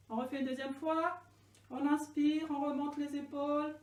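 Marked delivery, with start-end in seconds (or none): none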